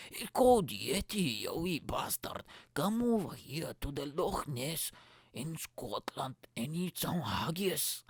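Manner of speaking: slow, hammed-up Scottish lilt; attempted Scottish accent. Podcaster inviting Yeti to eat haggis, badly faked Scottish accent. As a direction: stretch the words in mock Scottish. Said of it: "Scottish, but it just sounded".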